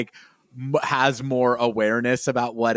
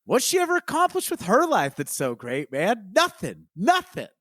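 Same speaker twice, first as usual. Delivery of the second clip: high, indignant mock outrage; a pitched-up incredulous comeback; incredulous Dignan; 10 semitones higher than usual. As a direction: leap up high defending your friend's honor. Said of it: "And Dignan goes".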